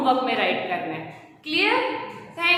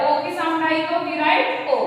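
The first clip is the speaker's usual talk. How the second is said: usual speaking voice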